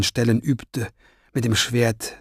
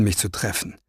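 ordinary delivery

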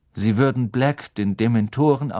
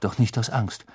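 plain reading voice

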